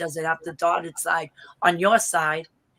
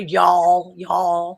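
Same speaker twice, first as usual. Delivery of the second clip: Southern y'all; 7 dB louder than usual. Retold or imitated. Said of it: "What else do they say?".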